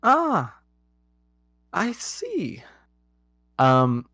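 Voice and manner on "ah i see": high, soft knowing royal aside; a queen unimpressed; queen's voice. Acted. Queen sizing up the newcomer, high and knowing.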